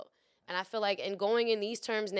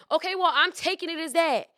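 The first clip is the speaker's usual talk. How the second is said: mock defiant; 7 semitones higher, 8 dB louder than usual. Retold quarrel, defiant reply.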